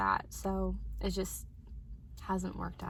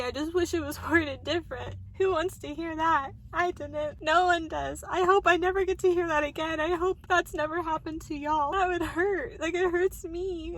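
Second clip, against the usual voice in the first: high, louder, tearful voice; crying to viewers about being hurt; 7 semitones higher, 4 dB louder than usual. Real, not acted. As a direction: sob the words out. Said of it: "I don't wanna keep crying".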